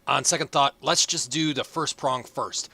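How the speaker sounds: put-on old advisor voice. Advisor character continues.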